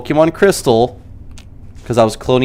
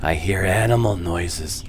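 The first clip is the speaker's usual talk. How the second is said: low slow quote; 5 semitones lower, 9 dB quieter, slower than usual. Retold or imitated. Quoted movie line.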